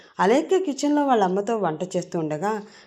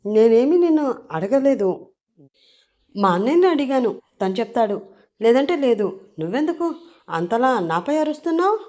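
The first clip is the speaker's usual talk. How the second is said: loud, higher-pitched defiant retort; shouting back; 5 semitones higher, 5 dB louder than usual. A narrator's reading. Girl answering the man who shouted at her, loud defiance. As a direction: snap back in a raised, high voice. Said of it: "గట్టిగా అరిచే చెప్తుంది".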